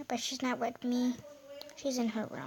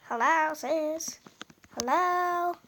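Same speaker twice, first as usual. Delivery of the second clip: calling out; 3 semitones higher, 4 dB louder, slower than usual. Real, not acted. Calling out to sister in game.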